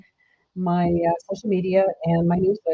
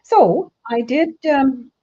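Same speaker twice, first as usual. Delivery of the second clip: normal talking voice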